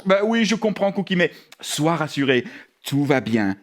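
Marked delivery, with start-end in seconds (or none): 1.2-3.6 low, calm comforting voice; reassuring. Acted. Host comforting scared little dog at bedtime, reassuring. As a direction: soothe gently in a lowered voice.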